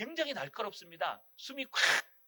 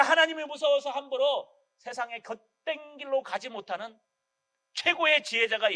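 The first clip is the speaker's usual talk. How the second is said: louder, driving sermon voice; fiery preaching; 3 semitones higher, 7 dB louder than usual. Acted. Pastor warning the congregation of God's discipline, fiery preaching. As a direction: pound each clause home to the congregation.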